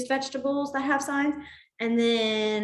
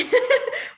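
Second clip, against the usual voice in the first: high laugh; 13 semitones higher, 5 dB louder than usual. Real, not acted. Laughing at comment.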